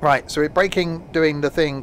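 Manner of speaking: ordinary talk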